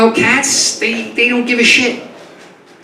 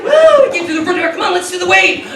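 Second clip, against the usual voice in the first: overexcited dog; 9 semitones higher than usual. Acted. Dog cheering to the cat at the front door, high and whooping. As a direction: whoop it up like a dog gone wild. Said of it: "He's like".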